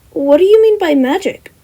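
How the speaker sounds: high, loud and curious; eager fanboy. Acted. Fanboy student asking about magic, high and curious. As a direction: lean in with wonder.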